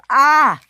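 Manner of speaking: loud, high acted shriek; sharp cry of alarm; crash scream. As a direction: scream as you fly off and crash. Engine crashes.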